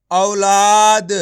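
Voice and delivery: औलाद drawn out very slowly and loud, au-laad; slow loud blend. Shown as a demonstration. Teacher blends the letters into औलाद. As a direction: stretch औलाद out for the class. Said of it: "तो मिलके बन जाएगा".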